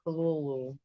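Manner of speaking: Kululu with the first syllable like clue; pronunciation demo. Shown as a demonstration. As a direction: say Kululu, klu-lu-lu, softly. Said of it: "the first syllable is not unlike clue".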